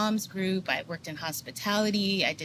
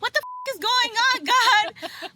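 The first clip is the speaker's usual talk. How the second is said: very high, louder at the end, near-shout of disbelief; incredulous cry to God; 6 dB louder, slower than usual. Retold or imitated. Questioning God about the awakening, very high and loud incredulous cry. Retelling panicked plea to God.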